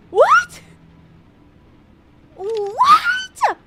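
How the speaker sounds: high, loud yelp of surprise; shocked disbelief. Real, not acted. Gamer discovering the notes, shocked shouting.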